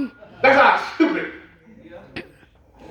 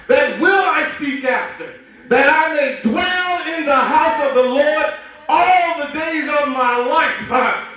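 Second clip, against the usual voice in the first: slow, stretched phrases climbing higher; fervent scripture reading; 5 semitones higher, slower than usual. Acted. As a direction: proclaim the psalm with growing fire. Psalm read with rising fervor.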